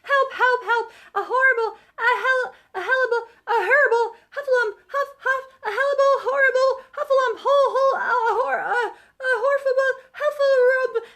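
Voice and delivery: high, loud stammering terror; fleeing and shrieking in a panic; panicked crying. A narrator's reading. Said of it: "still crying".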